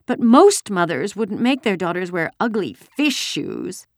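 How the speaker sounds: put-on high, complaining little girl, drawn out; indignant child. A narrator's reading. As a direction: grumble slowly like an offended small child. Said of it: "Kirsty bellowed".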